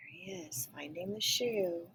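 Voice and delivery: soft whispered aside. Real, not acted. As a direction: share a secret softly.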